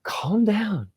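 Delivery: high, hushed breathy plea; exasperated self-talk. Acted. Driver calming himself in traffic, high hushed plea.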